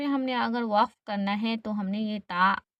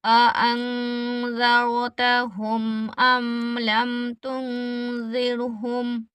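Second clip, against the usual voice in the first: slow, drawn-out tilawat; measured Quranic chant; Quran recitation; slower than usual. Shown as a demonstration. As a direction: recite the verse slowly for students to follow. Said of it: "अब हम रमा पढ़ रहे हैं".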